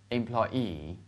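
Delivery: "employee" with the last syllable stressed and drawn out; light start, long stressed "-ee" in "employee"; stress on final EE. Shown as a demonstration. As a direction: model "employee" slowly, leaning on "-ee". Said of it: "把重音读出来".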